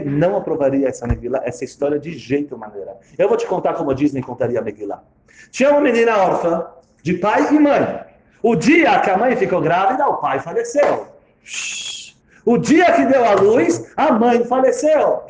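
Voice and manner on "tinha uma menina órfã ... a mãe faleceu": fairy-tale telling. Acted. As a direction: tell it like a Disney fairy tale. Tells story Disney style.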